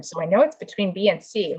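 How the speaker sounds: ordinary delivery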